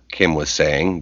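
ordinary delivery, nothing marked

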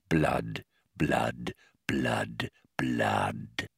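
fading menacing whisper. Acted. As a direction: sink to a sinister whisper. Mock menacing chant.

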